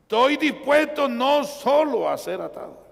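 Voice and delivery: high, loud declaration of readiness; ringing sermon proclamation; proclaiming resolve. Retold or imitated. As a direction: cry it out from the pulpit. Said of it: "Dele gloria a Dios".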